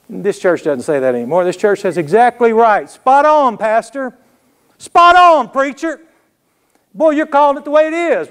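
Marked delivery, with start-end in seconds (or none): 2.0-8.3 high, hammed-up shouts of approval; acted amen-corner enthusiasm, pitched high; cheering congregation. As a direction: egg the preacher on from the pews. Acts out congregation cheering the preacher.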